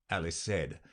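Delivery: ordinary delivery, nothing marked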